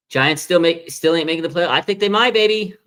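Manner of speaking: building excitement, calling out; hyped. Real, not acted. Hyping the Giants to chat, high and loud.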